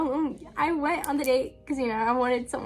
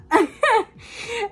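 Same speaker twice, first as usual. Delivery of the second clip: burst of laughter; 10 semitones higher, 6 dB louder than usual. Real, not acted. Laughs after admitting she lied.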